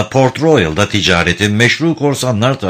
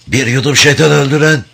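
higher, louder grating rasp; 7 semitones higher, 4 dB louder than usual. Acted. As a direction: rasp it out like a ruined throat. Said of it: "kulak tırmalayan hırıltılı bir sesle".

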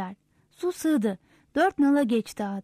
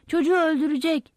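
higher-pitched cry of alarm; narrated panic, moderately voiced; 5 semitones higher than usual. A narrator's reading. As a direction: cry out in fear for a child. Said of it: "diye bağırdı".

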